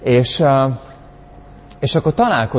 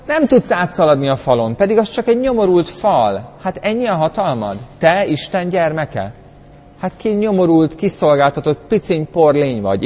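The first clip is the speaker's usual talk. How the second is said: higher, sneering taunting voice; a hint of a scornful tormentor; mocking taunt; 6 semitones higher than usual. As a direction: belittle the listener like a scornful bully.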